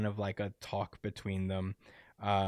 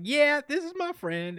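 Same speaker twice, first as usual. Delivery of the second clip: much higher, innocent voice; high sweet reply. Retold or imitated. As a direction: answer sweetly, pitched way up. Yuko voiced to neighbor.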